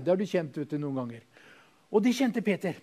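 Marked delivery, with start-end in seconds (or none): none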